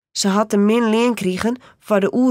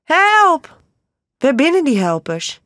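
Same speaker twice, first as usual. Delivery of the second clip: high, loud desperate cry for help, easing off; a woman cornered, shouting for help; screaming for help; 6 semitones higher, 4 dB louder, slower than usual. A narrator's reading. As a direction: yell for help in terror. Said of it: "Die Pika begint te schreeuwen".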